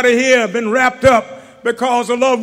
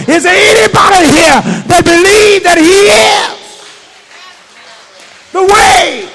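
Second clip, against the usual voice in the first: rising, shouted creed, louder line by line; preaching at full force; 4 dB louder than usual. Real, not acted. Preacher to his congregation, shouted rising creed. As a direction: preach it, stacking each we believe.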